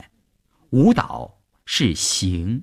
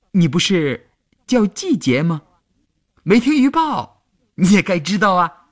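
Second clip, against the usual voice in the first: teasing; 10 semitones higher, 4 dB louder than usual. A narrator's reading. Boy teasing girl.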